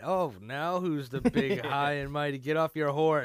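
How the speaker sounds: loud teasing jab; mocking taunt. Mocking high and mighty.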